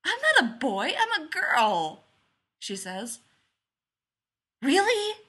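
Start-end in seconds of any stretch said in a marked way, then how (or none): 0.0-2.1 put-on high, loud little-girl correction; amused child. A narrator's reading. Joe correcting Jim who took her for a boy, amused. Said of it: "Joe giggles at that". 4.6-5.2 high, loud burst of surprise; amazed. A narrator's reading. Jim learning Joe is a girl, amazed.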